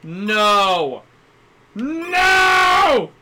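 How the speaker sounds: drawn-out groan into loud No; rising dismay to a yell; frustrated shout. Real, not acted. Sent back to earlier area.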